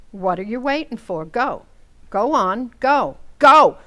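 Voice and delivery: escalating furious shout; angry order out. Acted. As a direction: drive him out the door, each Go harder. Wife throws husband out.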